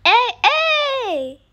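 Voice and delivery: loud, high call for attention. Real, not acted. Gamer calling to another player, loud shout. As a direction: shout to grab their attention.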